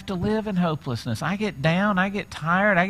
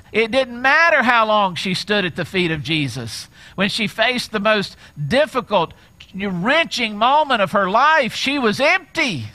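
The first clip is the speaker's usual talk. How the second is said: higher, louder, slowing on she was empty; rising preaching intensity; 7 semitones higher, 5 dB louder than usual. Acted. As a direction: preach it up, slowing on the last words. Preacher building to peak.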